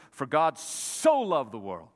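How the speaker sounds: SO stretched long and loud; stress on SO. Shown as a demonstration. Preacher quoting the verse to his church, SO emphasized. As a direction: lean hard on "so", drawing it out. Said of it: "I wanna emphasize the word so".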